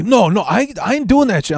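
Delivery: put-on high, Cheech-style Mexican accent; Mexican accent impression. Thief explains where he got the bike, Mexican accent. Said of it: "that typical Cheech Mexican accent".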